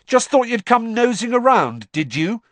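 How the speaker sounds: high, loud angry shout. A narrator's reading. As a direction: yell it at him furiously. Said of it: "Harry shouted".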